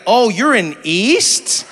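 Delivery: very slow stretched East with a rise; drawn-out question. Retold or imitated. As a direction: drag out East like a local. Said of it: "They'd be like".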